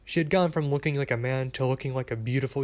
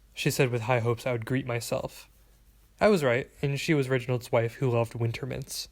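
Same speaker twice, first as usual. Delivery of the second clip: ordinary delivery, nothing marked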